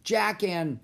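loud answer with a hitch before the last name; yelling back. A narrator's reading. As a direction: holler the names back. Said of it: "Annie yelled back".

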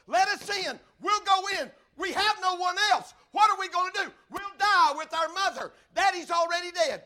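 loud, high shouted plea; desperate begging. Acted. Children shut out from their dying mother, begging loudly. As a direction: cry it out as if beating on a door. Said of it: "begging, beating on the door".

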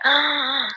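sharp startled intake of breath; shocked gasp. Real, not acted. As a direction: gasp at the eerie photo. Reacting to ghost photo. (jadeo de asombro)

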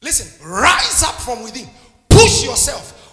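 loud, slow, rising shout; preacher's shout. Acted. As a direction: drive them up with a roar. Shouted exhortation.

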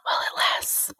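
breathy ominous whisper, unvoiced; spooky whisper. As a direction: whisper it like a dark warning. Ominous joke aside.

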